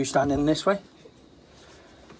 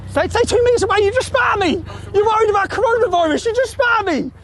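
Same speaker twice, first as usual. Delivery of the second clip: yelling, much higher and louder; furious shout; 13 semitones higher, 4 dB louder than usual. Real, not acted. Shouting at the cyclist who spat at him, furious. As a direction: roar it at the man who just spat on you.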